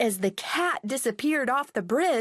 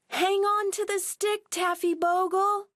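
high urgent call; cried out; 9 semitones higher than usual. Acted. Girls call to Taffy Bogle in the river, high urgent cry. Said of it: "they called".

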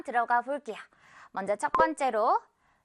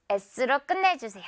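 ordinary talk

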